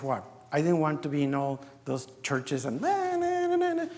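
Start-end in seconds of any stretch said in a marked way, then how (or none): 2.8-3.9 high mocking na-na chant; dismissive sing-song. Brushing off churchgoing, high mocking singsong. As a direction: wave off all those churches in a high singsong.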